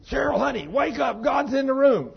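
high, loud urgent shout at his sleeping wife. Retold or imitated. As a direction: yell it to wake her in a panic. Husband waking wife, God present.

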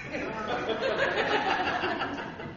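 a soft, high giggle; a quick high laugh. Real, not acted. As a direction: laugh quietly at his own threat. Laughs after his threat.

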